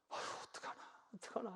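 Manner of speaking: breathy, soft moan of worry; anguished sigh. Retold or imitated. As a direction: fret under your breath like a worried parent. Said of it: "속상해하면서". (애타는 한숨)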